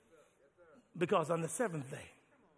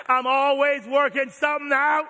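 louder emphatic repeat; 8 semitones higher, 15 dB louder than usual. Shown as a demonstration. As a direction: punch it out, louder. Repeats line louder second time.

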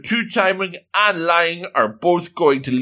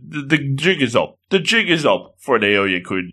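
normal talking voice

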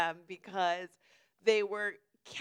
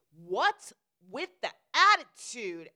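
loud, drawn-out indignant challenge; indignant confrontation; 12 dB louder, slower than usual. Retold or imitated. Lady confronting the storyteller on the train, indignant. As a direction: stretch it out, loud and affronted.

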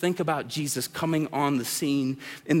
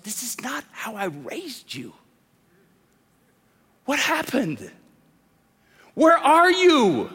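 a hushed parent that rises to a slow, higher cry; heartbroken parent; 5 semitones higher, slower than usual. Acted. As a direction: start hushed, then cry out the last question slowly. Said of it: "thinking to yourself".

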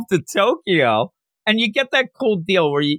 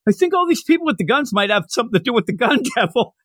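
higher, fast, dumbly obvious delivery; Captain Obvious; 6 semitones higher, faster than usual. Retold or imitated. Host mocks Makima stating the obvious, higher and fast. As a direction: state the obvious like it's a revelation. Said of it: "Captain Obvious over here".

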